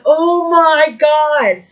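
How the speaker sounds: slow, drawn-out awed oh my god; a slow, high, hushed gasp of awe; awed crowd. Retold or imitated. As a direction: imitate the stunned crowd. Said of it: "you hear everyone".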